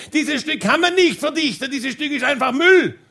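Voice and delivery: high-pitched indignant heckle; imitated outraged protest; acted yelling. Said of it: "meine Frau hat geschrien".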